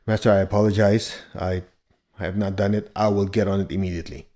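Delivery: lightly acted submissive reply to the master; apologetic student. Student apologizing to the master, acted meek compliance. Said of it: "the only thing I can do is".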